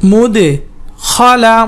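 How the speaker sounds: "মুদখালা" split by a pause after মুদ; broken-up pronunciation demo. Shown as a demonstration. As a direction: chant "মুদ", pause, then "খালা". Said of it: "উচ্চারণ করে পড়বেন". ("মুদখালা" ভেঙে ভেঙে উচ্চারণ)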